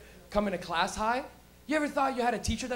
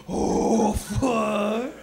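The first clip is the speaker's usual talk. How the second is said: mock dread. Caught, face gets hot.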